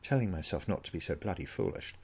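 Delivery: usual speaking voice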